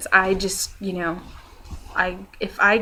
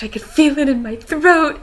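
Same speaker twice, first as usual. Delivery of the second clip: mock panic; 7 semitones higher, 7 dB louder than usual. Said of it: "freaking out about".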